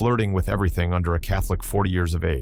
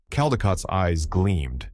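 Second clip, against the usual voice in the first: ordinary delivery, nothing marked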